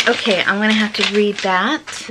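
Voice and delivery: ordinary delivery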